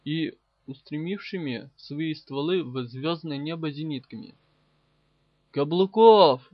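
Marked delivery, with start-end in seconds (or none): 5.5-6.5 loud yell into a comm line; bellowed name, much louder than the narration. A narrator's reading. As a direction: shout the name, calling him urgently. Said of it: "заорал я в проводок".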